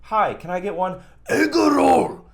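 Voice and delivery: hammed-up Chinese-accent caricature; mock Chinese accent. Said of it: "it's rude for me to go".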